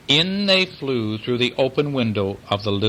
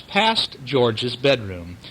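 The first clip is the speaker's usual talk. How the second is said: plain reading voice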